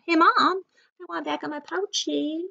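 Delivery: high little-penguin call; shouting out. A narrator's reading. Young penguin calling to kangaroo mom, high mock shout. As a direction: call out high, like a child to its mother. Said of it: "And then she shouted".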